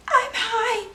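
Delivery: high, silly dopey voice, way up in pitch; dumbest voice. Retold or imitated. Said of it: "like, the dumbest voice".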